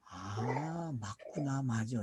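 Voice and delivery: hushed, low murmur to oneself; dawning realization. Acted. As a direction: softly act out seeing that it fits. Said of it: "그러면 이제 눈치채야지". (혼잣말처럼 낮고 조용하게 깨닫는 말투)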